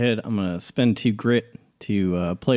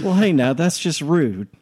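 mock offended; 6 semitones higher than usual. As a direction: play it up as a wounded rogue. Mock offense at being blocked.

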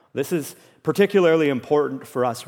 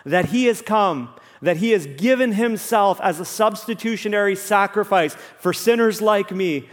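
raised-pitch, emphatic gospel proclamation; impassioned preaching; 5 semitones higher than usual. Real, not acted. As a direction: preach it with mounting fervor, punching key words. Preaches the gospel with rising force.

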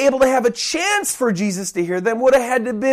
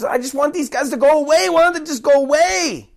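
higher, exasperated complaint; annoyed; 5 semitones higher, faster than usual. Acted. Follower of Christ sick of the noisy blind men, annoyed. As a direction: act out being fed up with pests. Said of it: "they really became annoying".

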